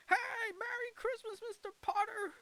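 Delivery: gleeful, very high sing-out greeting; hammed-up cheery holiday hail; George Bailey. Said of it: "He even wishes Mister Potter".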